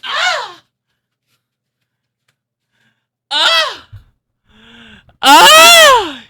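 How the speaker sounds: high squeals building to a loud one; excited squeals. Real, not acted. Streamer reacting to the unboxing, excited squeals.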